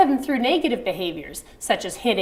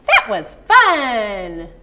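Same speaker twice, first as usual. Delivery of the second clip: slow, louder and drawn out; delighted; 6 dB louder, much slower than usual. Shown as a demonstration. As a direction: savor how fun it was. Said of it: "tone of voice match the emotion".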